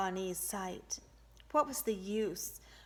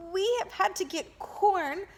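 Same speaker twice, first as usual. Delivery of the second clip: high, pressing half plea; half pleading; 12 semitones higher, 7 dB louder than usual. A narrator's reading. The woman explaining to the governor, half pleading. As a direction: beg a little for understanding. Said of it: "she half pleaded".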